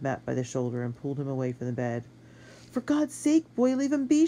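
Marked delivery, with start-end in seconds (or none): 2.7-4.3 very high, impassioned woman's outburst; anguished cry. A narrator's reading. Sister protecting her wounded brother from the boy, anguished high cry. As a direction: cry out, pleading and fierce. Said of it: "she cried".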